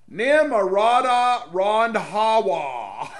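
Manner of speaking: loud, high, drawn-out name; mock announcer shout. Host ridiculing politician's full name, mocking shout. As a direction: belt out the name in mockery, very slowly.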